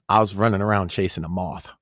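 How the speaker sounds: ordinary talk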